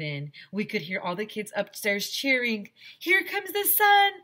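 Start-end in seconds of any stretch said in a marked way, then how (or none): 3.0-4.1 very high, loud, slow cheer; joyful shout. Acted. Rescued Arlo cheering at the light, very high and loud. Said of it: "I shouted".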